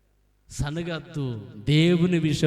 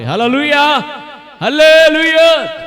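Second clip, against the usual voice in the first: loud, high hallelujah, building to a drawn-out second; full-force rallying cry; praise shout; 10 semitones higher, 10 dB louder than usual. Real, not acted. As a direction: call it out, then bigger and longer. Repeated call, second bigger.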